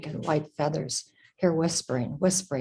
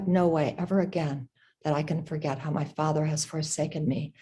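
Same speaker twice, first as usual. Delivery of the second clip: usual speaking voice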